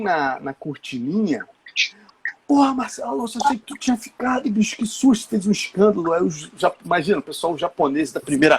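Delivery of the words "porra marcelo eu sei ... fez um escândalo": much higher, excited relieved yell; relieved shouting. Retold or imitated. Friend bursting in through the curtain, high relieved shouting. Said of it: "aqueles gritos do Carson".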